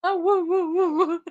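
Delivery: high mock howling woo; imitating dog howl. Mimicking the dog howling along with the crying woman. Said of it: "Sounds just like her".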